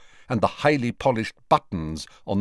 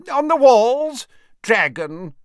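high, loud shout over a din; character's raised, high-pitched yell; 14 semitones higher, 7 dB louder than usual. A narrator's reading. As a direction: holler the answer across the noise. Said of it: "shouted the wizard called Horace".

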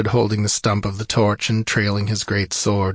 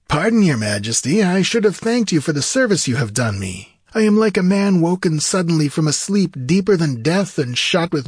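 high, lilting, theatrical voice; high-voiced wizard, formal then keenly inquiring; courtly sorcerer, grateful; 8 semitones higher, 3 dB louder than usual. A narrator's reading. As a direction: thank the king grandly in a high register.

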